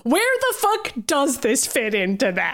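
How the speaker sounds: high mock exasperation with a laugh; exasperated agreement. The viewer answering Kurt, a high laughing exasperation. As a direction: throw up your hands at the script. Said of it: "I was like".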